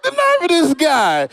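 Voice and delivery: high, loud, slow indignant; comic outraged that a fan corrected his joke book, high and slow; mock outrage. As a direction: drag it out, offended.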